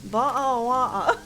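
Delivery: mock British "Boa of water" with "of" said as "ah"; British accent, "of" as "ah". Shown as a demonstration. Gamer corrects their British take, "of" becomes "ah" in mock British accent. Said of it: "they say ah".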